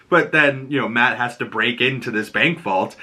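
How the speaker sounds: ordinary talk, nothing marked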